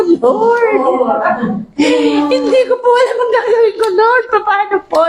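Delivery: high, pleading, half-laughing half-crying to the Lord; desperate prayer. Retold or imitated. Alone in the room, crying out to the Lord, pleading and near tears.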